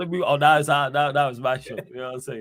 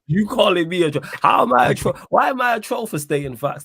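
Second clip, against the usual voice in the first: indignant protest; 7 semitones higher, 5 dB louder than usual. Real, not acted. Protesting being called a troll.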